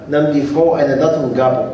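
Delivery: ordinary talk, nothing marked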